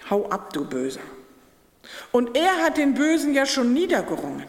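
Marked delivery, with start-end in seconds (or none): 0.1-1.0 low banishing command. Retold or imitated. Preacher voicing Christ sending the evil one away, low stern command. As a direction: drop low and send evil away firmly.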